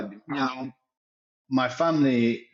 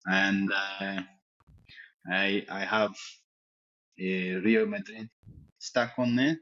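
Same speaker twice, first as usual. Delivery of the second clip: footballer's put-on French accent, lightly done; fake French accent. Interviewed as a fake Giroud, mild French accent. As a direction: play a cocky French striker, accent on.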